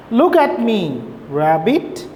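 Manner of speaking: normal talking voice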